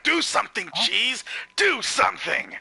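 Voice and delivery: loud, high exasperated yell; grito de torcedor irritado. Retold or imitated. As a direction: imitate a frustrated fan urging the player on. Angry fans urging their player.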